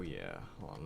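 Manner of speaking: playful low growl of "Oh, yeah"; deep joke voice. Pretend. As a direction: drop the voice way down for a goofy "Oh, yeah". Dropped into a deep voice.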